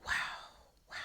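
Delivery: very soft, retold disbelief; hushed, with a long pause between words; stunned murmur. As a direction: barely voice it, taken aback. Retelling being stunned by son's words.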